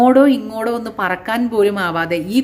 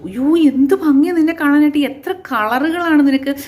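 higher and louder, gushing over the colours; excited admiration; 7 semitones higher, 3 dB louder than usual. A narrator's reading. Crow gushes at the bright parrot, excited and loud. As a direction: burst out in amazement.

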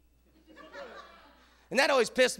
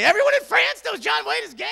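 higher, incredulous echo; outraged disbelief; 8 semitones higher than usual. Retold or imitated. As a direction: throw her words back in disbelief. Incredulous repeat of her claim.

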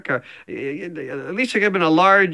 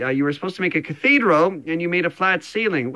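ordinary talk

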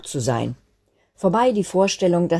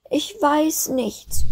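high, slow, tearful little voice; sobbing; 11 semitones higher, much slower than usual. A narrator's reading. Snowflake cannot say where to land, sobbing. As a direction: draw it out in a high whimper. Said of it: "schluchzte sie".

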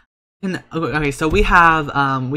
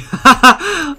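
high burst of laughter; 13 semitones higher, 10 dB louder than usual. Real, not acted. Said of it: "I'm laughing".

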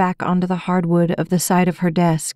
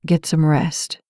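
lower, gentle man's voice; soothing; 3 semitones lower than usual. A narrator's reading. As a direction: tuck her in with the words. Jeff soothing her to sleep.

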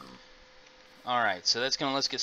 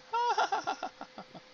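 high laugh. Real, not acted. Laughs at forgetting items.

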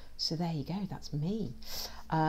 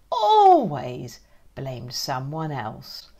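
slow stressed always; 3 semitones lower, 5 dB louder, much slower than usual. Acted. Slowed emphatic always.

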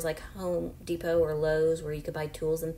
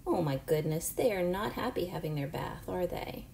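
ordinary talk

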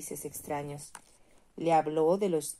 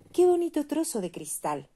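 put-on high, loud, thrilled little girl; delighted girl; 11 semitones higher, 7 dB louder than usual. A narrator's reading. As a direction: squeal of joy at a pretty find. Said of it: "exclamó la niña".

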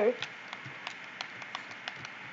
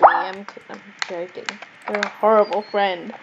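ordinary talk, nothing marked

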